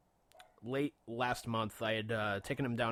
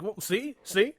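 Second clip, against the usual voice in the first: 1 mock scolding; 9 semitones higher, 7 dB louder than usual. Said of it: "I was like".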